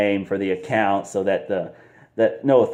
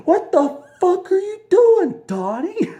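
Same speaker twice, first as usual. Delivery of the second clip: much higher, slow outraged shriek; wife yelling; 4 dB louder, slower than usual. Retold or imitated. Wife catching Donnie acting strange, high outraged yell. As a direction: scream at him, appalled. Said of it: "His wife catches him".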